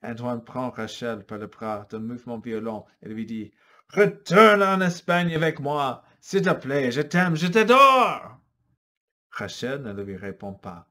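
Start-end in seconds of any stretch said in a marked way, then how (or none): 3.9-8.4 forceful plea. Acted. Antoine urging Rachel forcefully.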